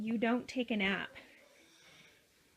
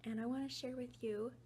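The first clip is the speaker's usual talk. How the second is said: hushed voice; 3 semitones lower, 10 dB quieter than usual. Real, not acted. Daughter asleep nearby, talking hushed.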